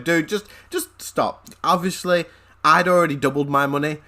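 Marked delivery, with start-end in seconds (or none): none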